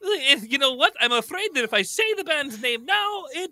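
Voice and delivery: high little character voice, laughing; little Italian guy pleading; tiny squeaky voice. Acted.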